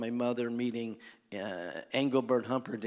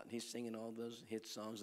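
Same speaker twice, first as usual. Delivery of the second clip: normal talking voice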